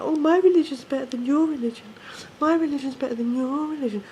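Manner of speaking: high, whiny mock-bickering; sing-song mockery of arguing believers; mocking squabbler. Mimics people bickering over religion.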